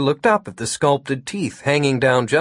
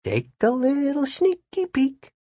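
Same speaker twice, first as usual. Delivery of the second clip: inner thought voice; 13 semitones higher than usual. Acted. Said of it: "Jimmy thought".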